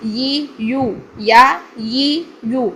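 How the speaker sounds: ی، یو، یا said gently and drawn out; gentle correct یا demo; soft ya drill. Shown as a demonstration. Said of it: "نرمی سے".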